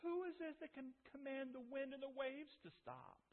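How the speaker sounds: a stunned whisper of wonder; bewildered awe. Acted. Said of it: "And he said".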